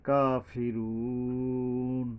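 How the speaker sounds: কাফিরুন with the long ু held for three alif beats; কাফিরুন sung slowly, its last vowel lengthened; drawn-out recitation. Shown as a demonstration. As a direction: stretch the ু in কাফিরুন, slow and sung. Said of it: "এই ওয়াও এর উপরে তিন আলিফ টানতে হবে".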